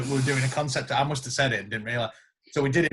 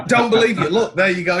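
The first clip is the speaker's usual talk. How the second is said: a louder, higher mock of the doubting wife; suspicious wife; 5 semitones higher, 7 dB louder than usual. Wife accusing husband at the computer, mock suspicious. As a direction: play the missus catching him out. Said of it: "my missus will come in".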